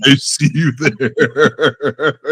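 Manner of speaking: slow, drawn-out words breaking into laughter; laughing. Real, not acted. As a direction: say it through a long laugh. Joking about moving to Nigeria, laughing.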